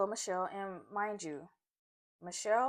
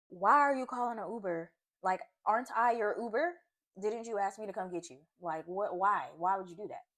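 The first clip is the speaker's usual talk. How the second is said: loud, exasperated questioning; heated, loud scolding; angry rant; 8 dB louder than usual. Retold or imitated. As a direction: fire the questions off in disbelief. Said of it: "So I was mad".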